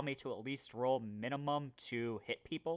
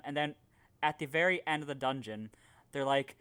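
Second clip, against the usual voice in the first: ordinary talk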